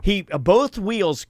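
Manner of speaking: usual speaking voice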